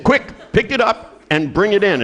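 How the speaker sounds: mock urgent command. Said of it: "they said".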